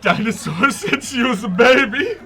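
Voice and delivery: mock wail over a lost pet dinosaur; sobbing. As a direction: Ephraim blubbering about raising his dinosaur. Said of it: "went from sobbing".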